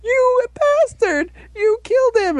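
very high, slow melodramatic wail; mock anguished outrage. Acted outrage at fallen friend's killer.

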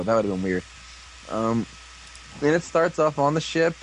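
ordinary talk, nothing marked

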